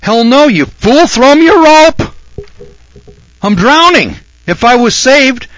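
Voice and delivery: high, loud, exasperated yelling; drowning man shouting. Retold or imitated. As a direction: bellow at the fool on the bank to throw the rope.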